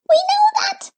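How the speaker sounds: very high, fast little creature; squeaky creature voice. A narrator's reading. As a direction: chirp it out like a tiny forest creature. Gleek speaks.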